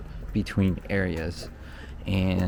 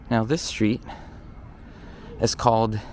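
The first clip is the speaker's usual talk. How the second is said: ordinary delivery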